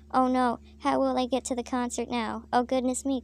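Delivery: plain reading voice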